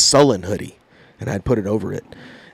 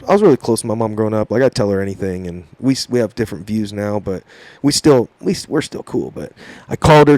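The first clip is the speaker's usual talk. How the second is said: usual speaking voice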